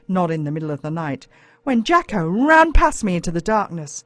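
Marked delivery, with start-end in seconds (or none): none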